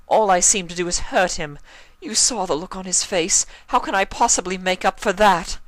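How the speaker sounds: high, refined mare voice, upset and pleading; voiced distraught Rarity. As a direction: play Rarity torn up with guilt.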